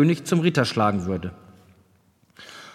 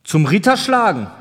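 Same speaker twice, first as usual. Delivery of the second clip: louder, higher questioning repeat; a king's pointed echo of a request; deliberate echoed question; 5 semitones higher, 5 dB louder than usual. A narrator's reading. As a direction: throw the words back, raised and emphatic. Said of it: "wiederholte er langsam".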